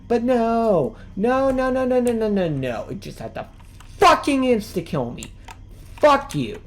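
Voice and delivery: loud, high repeated no's; angry outburst. Real, not acted. Gamer yelling at the game after dying, loud and high. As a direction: hammer each no out in rage.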